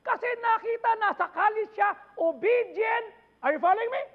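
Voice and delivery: loud, driving sermon delivery; rousing sermon voice; full-force preaching. Acted. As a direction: preach it hard to the crowd. Preaching to the congregation.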